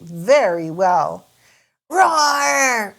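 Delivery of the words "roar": playful mock roar; dinosaur roar. George wakes Peppa, playful mock roar. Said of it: "cries George".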